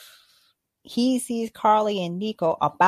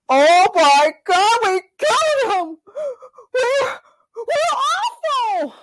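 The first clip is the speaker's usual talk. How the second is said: mock horror; 12 semitones higher, 5 dB louder than usual. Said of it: "Carly and Flag Smasher were like".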